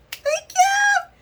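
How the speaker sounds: tiny high-pitched thank you; squeaky thank you. Retold or imitated. Vlogger thanking the yarn clerk, squeaky high.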